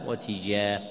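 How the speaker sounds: "وتجاه" with i after the ta; kasra on the ta. Shown as a demonstration. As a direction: say "وتجاه" as wa-tijah. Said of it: "والكسر، فيقال".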